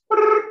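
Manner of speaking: mock CPAP mask rumble; high trilled mask noise; buzzing through a CPAP mask; CPAP mask breath. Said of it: "all you heard was".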